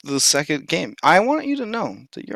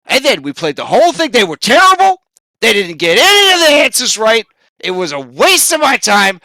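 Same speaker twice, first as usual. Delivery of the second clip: high and loud complaining, peaking on "terrible"; a fed-up host airing every grievance; Steve Harvey angry rant; 9 semitones higher, 5 dB louder than usual. Acted. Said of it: "And he says".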